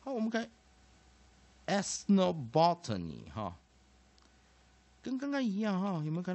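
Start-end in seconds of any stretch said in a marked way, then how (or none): 1.7-3.3 slow, loud, carefully stressed word; model pronunciation. Shown as a demonstration. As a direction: present the new word to the class. Teacher models the whole word.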